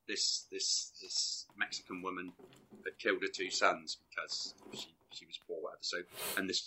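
soft, half-whispered ghost-story telling; hushed spooky storytelling. Retold or imitated. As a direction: hush it like a campfire tale. Spooky retelling of the curse.

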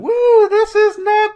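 high, slow, loud muffled yell from behind a door. Acted. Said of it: "muffled voices behind it yelling".

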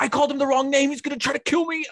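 high-pitched mock panic; imitated panicking little brother. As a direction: play a kid fearing for his life. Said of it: "He was like".

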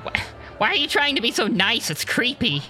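high, jittery and defensive; upset nervous alien. Acted. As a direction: back away, creeped out. Lee Bashir upset, pushing away.

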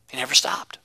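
breathy whispered echo. Real, not acted. Preacher repeating his point in a whisper. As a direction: whisper it like a secret.

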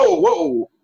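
high startled yelp of surprise; startled whoa. Retold or imitated. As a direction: jump back in mock alarm. Dog barks at Mikey, startled reaction.

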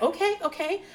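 high-pitched, placating okays; retold teacher. Teacher answering the student whispering to her, high imitated acknowledgement. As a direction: mimic a teacher acknowledging a child's prompting. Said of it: "I could hear him whispering to her".

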